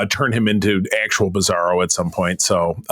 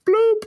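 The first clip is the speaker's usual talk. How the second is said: mock drop sound.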